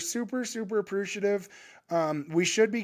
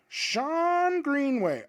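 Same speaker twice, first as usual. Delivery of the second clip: drawn-out name; 8 semitones higher, much slower than usual. Shown as a demonstration. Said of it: "let me find Sean Greenway".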